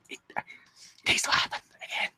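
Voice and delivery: soft, voiceless whisper; overwhelmed whisper. Real, not acted. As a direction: let the voice give out into a whisper.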